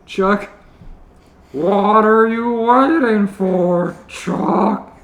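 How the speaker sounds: high, slow whine; whining. Acted. Panty whining at Chuck to come down. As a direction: drag it out like a whiny kid. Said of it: "Still whining".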